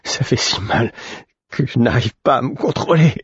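high, strained cry of pain; pained cry as numb hands wake. Acted. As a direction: push it out through the hurt. Boy in agony from returning feeling.

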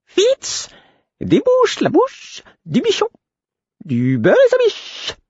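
very high, slow fluting chant; drawn-out, fluty nonsense rhyme; piping fool's voice. A narrator's reading. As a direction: pipe each word out slowly, far up high. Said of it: "d'une voix flûtée".

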